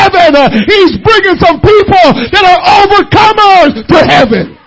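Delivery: very loud, driving sermon cadence; shouted pulpit climax; full-force preaching. Real, not acted. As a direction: hammer each phrase out to the congregation.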